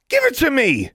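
very high, loud desperate scream. A narrator's reading. Girl fighting for her time turner, screaming. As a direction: scream the demand. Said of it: "Alexandra screamed".

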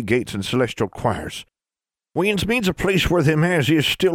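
lightly voiced Ozark granny; a hint of an old hill woman; country granny voice. A narrator's reading. Granny Pritchard speaks.

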